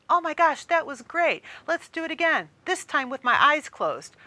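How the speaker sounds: high, lively retelling; excited chatter. Retold or imitated. Friends in line gushing about the ride. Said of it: "you talk about the experience".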